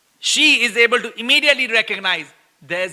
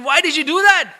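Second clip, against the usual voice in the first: annoyed scolding; 6 semitones higher, 4 dB louder than usual. Retold or imitated. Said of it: "So he's like".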